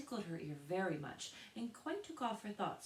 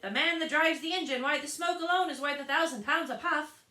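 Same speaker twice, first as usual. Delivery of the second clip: loud, much higher mocking crowd; chorus of voices; 11 semitones higher, 13 dB louder than usual. A narrator's reading. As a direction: chime in as a jeering chorus. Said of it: "the chorus of voices went on".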